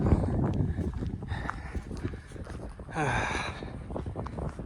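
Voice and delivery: long weary exhale; tired sigh. Real, not acted. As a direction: sigh out the exhaustion.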